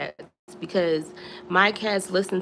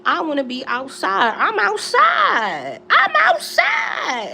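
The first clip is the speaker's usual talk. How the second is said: high, slow, rising to a shouted I'm outside; sassy cat voice, building; 8 semitones higher, 4 dB louder than usual. Retold or imitated. Cat refusing to come home, shouting gleefully. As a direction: let it climb into a gleeful yell. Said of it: "But she's like".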